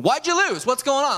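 high-pitched, indignant demand; competitive outburst. Retold or imitated. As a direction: burst out like an over-competitive coach. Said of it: "I'm kind of competitive".

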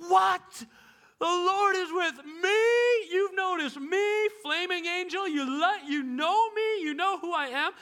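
high, loud and astonished; a girl stunned that heaven knows her; thrilled disbelief. Pretend. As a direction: squeal with disbelief. Imagined Mary thrilled.

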